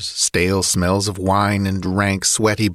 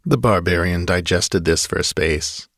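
ordinary delivery, nothing marked

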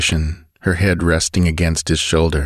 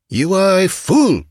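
high-pitched, contemptuous snarl; cold snarl; 13 semitones higher than usual. A narrator's reading. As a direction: snarl it at an underling. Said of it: "words came in a cold snarl".

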